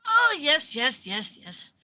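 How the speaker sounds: haughty, gushing, high start; pretentious voice. Acted. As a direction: grandly welcome the guest in. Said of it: "the same pretentious voice goes".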